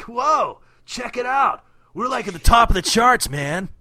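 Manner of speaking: high, loud, surfer-dude turtle voice; Michelangelo voice. Retold or imitated. Recapping the cartoon to his cohost, loud, high surfer-turtle voice. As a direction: hype it up like a stoked party-dude turtle. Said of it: "Did you just do Michelangelo's voice".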